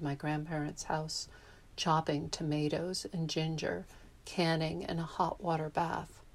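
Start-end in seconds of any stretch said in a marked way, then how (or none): none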